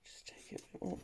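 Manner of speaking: very soft whisper. Real, not acted. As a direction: whisper to yourself, focused. Whispered to self while cutting.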